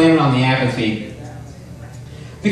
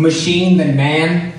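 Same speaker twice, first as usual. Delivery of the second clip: ordinary delivery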